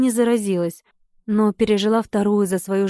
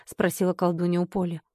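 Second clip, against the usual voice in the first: ordinary delivery, nothing marked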